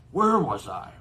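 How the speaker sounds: big, rough-edged giant reading his note aloud; a hearty giant dictating a letter; Hagrid's voice. Acted. Giant reads his note to Dumbledore aloud, bluff and loud.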